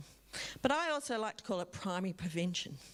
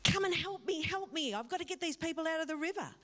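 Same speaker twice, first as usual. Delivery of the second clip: urgent plea; 7 semitones higher than usual. Retold or imitated. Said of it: "you ask them".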